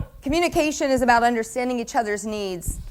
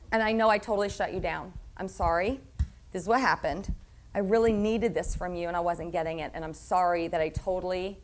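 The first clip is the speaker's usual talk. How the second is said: soft, lower, humble apology; sincere apology; 5 semitones lower, 7 dB quieter than usual. Shown as a demonstration. Circling back to the hurt person, soft sincere apology. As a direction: say sorry gently, meaning it.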